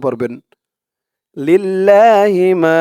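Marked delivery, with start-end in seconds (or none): 1.4-2.8 slow chanted লিল্লাহি মা; teacher's slow recited model; recited demo. Shown as a demonstration. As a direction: draw out লিল্লাহি মা slowly as in recitation. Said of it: "আমার সাথে সাথে ঠোঁট নাড়িয়ে বলবেন".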